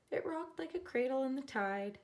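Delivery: ordinary delivery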